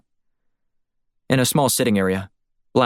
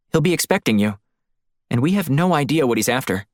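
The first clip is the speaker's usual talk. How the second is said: higher-pitched woman's voice, concerned; cautioning female colleague; 5 semitones higher than usual. Acted. Woman warning her partner about the man expecting him, higher and concerned.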